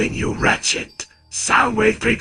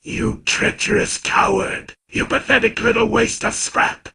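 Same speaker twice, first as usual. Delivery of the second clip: furious robot villain roar; 7 semitones higher than usual. Acted. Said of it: "Megatron was furious".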